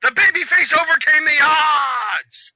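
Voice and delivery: high, loud shout, last word stretched; mock triumphant announcer. Fan announcing the hero's win, mock triumphant shout. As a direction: proclaim it like a hammed-up victory call.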